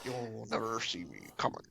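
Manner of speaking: low, hushed, bad put-on deep accent; terrible Mandarin accent. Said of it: "my terrible accent".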